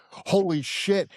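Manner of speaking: drawn-out, high-pitched awe; so excited. Retold or imitated. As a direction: imitate a thrilled onlooker, stretching the words. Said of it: "He was so excited".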